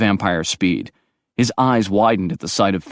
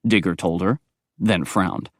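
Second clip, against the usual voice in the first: usual speaking voice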